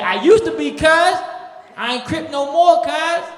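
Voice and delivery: proud reformed gangster; ex-Crip voice. Retold or imitated. Former Crip tells the comic he quit, loud and high.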